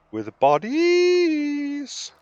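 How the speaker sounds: high, slow, drawn-out glee; gleeful. Acted. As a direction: stretch it out with wicked delight. Gleeful aside about battle plan.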